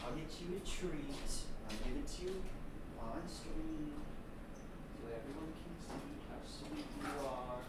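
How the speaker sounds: quiet, tender words to a pet; soft cat talk. Real, not acted. Streamer softly promising his cat a treat on stream, tender. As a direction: sweet-talk the kitty.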